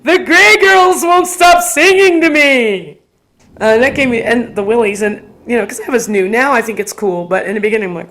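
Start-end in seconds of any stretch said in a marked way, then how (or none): 0.0-3.0 put-on high, loud, drawn-out toddler wail; crying toddler. Fussy toddler complains to the tour, mock wailing. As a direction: wail it like an upset little kid, imitated. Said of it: "another toddler that kept throwing himself to the floor and crying".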